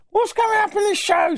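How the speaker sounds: high mocking listener voice. Host mimicking a listener asking what's next, high mock voice. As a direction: squeak the question like a nagging listener. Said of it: "I hear you say".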